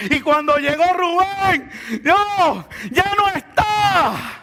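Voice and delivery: high, slow, drawn-out shout; ringing pulpit climax; climactic full-force preaching. Real, not acted. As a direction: stretch every word into a triumphant cry.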